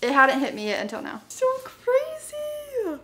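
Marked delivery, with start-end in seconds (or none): 1.3-3.0 high, drawn-out amazed exclamation; stunned awe. Real, not acted. Realising the award is real, high drawn-out amazement. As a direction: let the disbelief stretch out.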